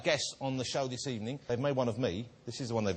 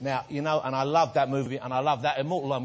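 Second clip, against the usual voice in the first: normal talking voice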